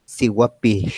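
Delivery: "Sihuapil" with the last L blown out sideways; voiceless final L. Shown as a demonstration. Teacher repeats for learners, "Sihuapil" with hissed final L. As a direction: end "Sihuapil" on a long voiceless hiss of L. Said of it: "el aire sale por los lados".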